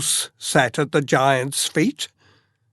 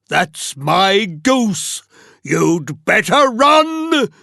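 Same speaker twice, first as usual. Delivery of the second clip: giant's roar; slower than usual. Acted. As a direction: roar at the thief with rising menace. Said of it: "he roared".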